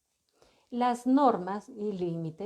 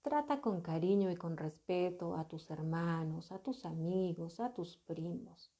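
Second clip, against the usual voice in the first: soft and tender, trailing quieter; gentle positive instruction; 4 semitones lower, 8 dB quieter than usual. Shown as a demonstration. Parent gently telling child to treat siblings kindly, soft tender voice. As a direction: coax the child kindly, softly. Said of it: "cómo lo reformulamos en positivo".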